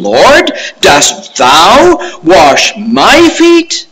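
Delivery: thou and my drawn out and pushed high; "thou" and "my" stretched slow, loud and rising; stress on thou and my. Acted. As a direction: lean hard on "thou" and "my" in astonishment. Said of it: "the Greeks had a way of emphasizing the pronoun".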